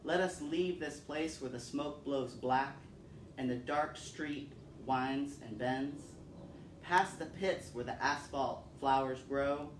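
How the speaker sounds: ordinary delivery, nothing marked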